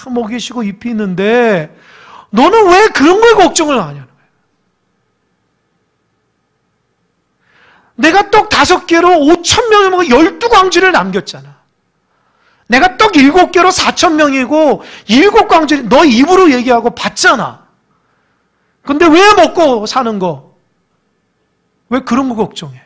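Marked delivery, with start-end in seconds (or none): 2.3-11.6 loud, higher preaching at full force; fiery preaching. Acted. Pastor voicing Jesus to the congregation, loud and fervent. 12.7-15.9 내가 떡 일곱 개로 sung out high and loud; loud preaching. Acted. Pastor retelling the miracle to the congregation, loud. Same line preached loudly. 15.9-22.8 loud, higher preaching at full force; fiery preaching. Acted. Pastor voicing Jesus to the congregation, loud and fervent.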